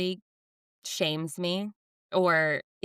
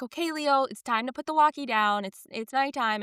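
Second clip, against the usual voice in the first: gentle mom voice; 7 semitones higher than usual. Retold or imitated. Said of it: "I'm like".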